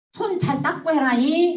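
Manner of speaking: teasing singsong through laughter; giggling mock warning to the boys; laughing tease.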